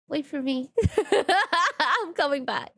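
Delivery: giggling through the words; high laughs breaking up the talk; can't help laughing while calling out. Real, not acted. Giggling fit while playing.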